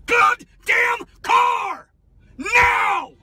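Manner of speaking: screaming, high and slow; berserk rage. Real, not acted. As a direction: voice cracking with fury. Driver screams at passenger.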